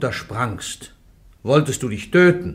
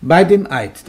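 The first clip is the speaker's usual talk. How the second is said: stern command; 5 semitones higher, 9 dB louder than usual. Acted. Judge commands Joan under oath.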